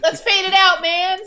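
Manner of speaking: high, loud dude voice; laughing stab at a Wayne impression. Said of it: "I can't do impressions".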